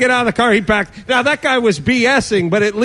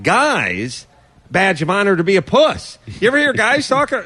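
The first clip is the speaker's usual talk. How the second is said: ordinary delivery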